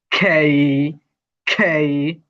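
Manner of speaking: খেই with a strong puff of air; aspirated খেই, air blowing out; aspirated sound. Shown as a demonstration. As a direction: push খেই out on a burst of breath. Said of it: "aspirated sound হবে এটা".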